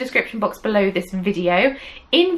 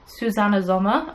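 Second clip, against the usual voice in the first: designer's name in a German accent. Shown as a demonstration. Host naming the shrug's Austrian designer, German accent. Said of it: "I always say it in a German accent".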